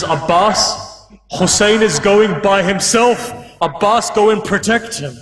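high, urgent appeal for help; acted sister's panic; panicked plea. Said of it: "Zainab begins to panic".

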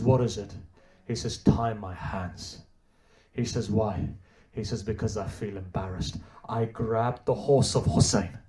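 low, soft grieving sermon voice; preacher softly mourning Hur's repentance; hushed mournful telling. Acted. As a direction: draw the crowd in quietly. Quiet mournful telling of Hur.

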